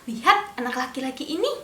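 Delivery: usual speaking voice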